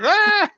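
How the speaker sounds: short, very high cry of alarm; mock shriek. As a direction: yelp it out in pretend shock. Startled by the angels' rebuke.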